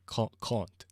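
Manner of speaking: "can't" with a long, rounded British "ah"; British pronunciation. Shown as a demonstration. Showing how they say it, British "can't". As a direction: try out the British "can't", starting and restarting it.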